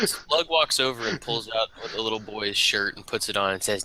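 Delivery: normal talking voice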